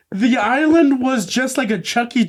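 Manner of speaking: usual speaking voice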